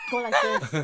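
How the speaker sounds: squeaky high show-and-tell; high demonstrating voice. Retold or imitated. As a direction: pitch it way up as you show them. Shows the class what shift means.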